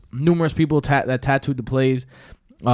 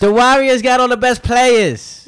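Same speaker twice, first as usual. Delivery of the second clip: put-on slow, high Boston accent drawl; mocking Boston accent, much higher and louder; 11 semitones higher, 6 dB louder, slower than usual. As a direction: stretch it out in a thick Boston accent. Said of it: "that accent is horrendous".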